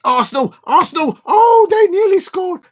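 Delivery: loud, high cry at a near goal; excited shout. Real, not acted. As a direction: shout it as the ball almost goes in. Reacting live to a near goal.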